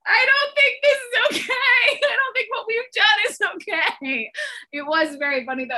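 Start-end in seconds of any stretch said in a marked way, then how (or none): 0.1-4.3 very high, loud laughing squeal; cracking up in mock protest; laughing while talking. Real, not acted. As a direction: wail it through helpless laughter. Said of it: "I can't say it without laughing".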